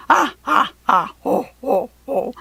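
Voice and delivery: big booming ha ha ho ho; loud belly laugh. A narrator's reading. Jack Frost roars with laughter at the fairies. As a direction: roar with laughter, holding your belly. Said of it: "beside himself with laughter".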